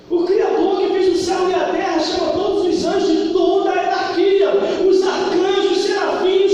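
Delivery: loud, high proclamation; preacher to congregation, booming; rousing preaching. Acted. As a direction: shout out the list of angels. Preaching at full force.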